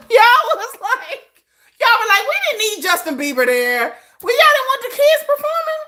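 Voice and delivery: high, laughing mock of the chat. Real, not acted. Host mocking viewers' [name] opinion, laughing high.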